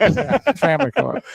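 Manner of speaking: amused laughing repeat. Real, not acted. Guest echoes while amused.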